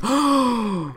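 sharp high intake of breath; a real gasp of realization; surprised gasp. Real, not acted. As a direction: gasp at the discovery. Realizing the flower connection.

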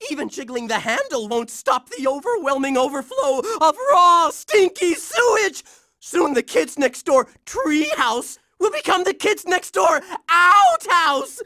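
normal talking voice

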